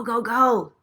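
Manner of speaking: normal talking voice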